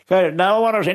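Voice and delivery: high, insistent protest; wife insisting to husband. Retold or imitated. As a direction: refuse to leave, wanting to look. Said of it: "wife insists".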